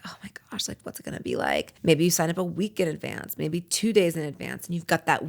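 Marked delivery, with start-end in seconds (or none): 0.0-1.7 breathless whispered wonder, voice dropping low; excited whisper. Retold or imitated. Nervous beginner whispering to herself before class. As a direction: whisper it like a secret thrill.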